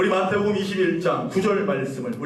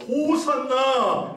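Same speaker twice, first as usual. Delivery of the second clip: high, drawn-out acclamation; stretched, high-pitched shout of praise; crowd crying out; 6 semitones higher, much slower than usual. Acted. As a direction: cry it out long, like a waving crowd. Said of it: "외치기를 시작하는 거예요".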